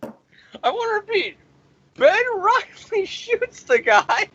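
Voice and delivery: high-pitched, laughing through the words; laughing in disbelief. Real, not acted. Reviewer repeating an absurd comic twist to viewers, laughing in disbelief.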